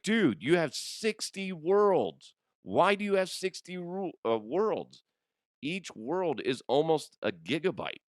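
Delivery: high, incredulous retold scolding; exasperated. Dad confronting his son about Minecraft worlds, mock exasperation. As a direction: protest in high-pitched disbelief.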